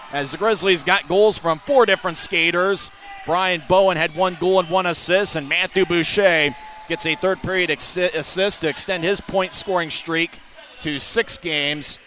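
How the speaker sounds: usual speaking voice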